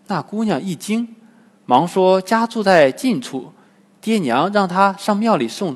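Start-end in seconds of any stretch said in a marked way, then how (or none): none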